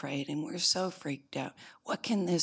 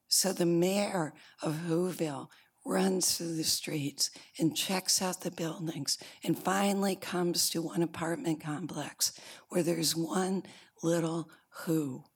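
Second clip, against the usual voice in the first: ordinary delivery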